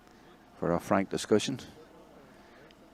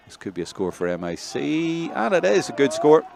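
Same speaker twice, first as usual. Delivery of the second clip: live play-by-play; 9 semitones higher, 7 dB louder than usual. Real, not acted. Commentator sees a score for MIC, excited lift. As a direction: build up to a loud, high call.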